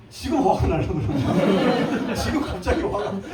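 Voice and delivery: slow, amused chuckle through the words; real giggle breaking in; laughing to himself. Real, not acted. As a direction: laugh at your own joke. Jokes he is getting angry.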